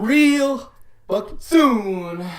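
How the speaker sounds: high, hugely drawn-out words; hammed-up echo. Cohost echoes the coming-soon plug, hamming it up. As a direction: stretch each word out like a showman.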